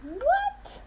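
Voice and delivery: high, sudden what; surprised. Real, not acted. Parent reacting to the twist, high with surprise. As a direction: jump up in disbelief.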